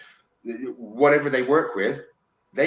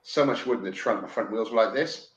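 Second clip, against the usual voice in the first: normal talking voice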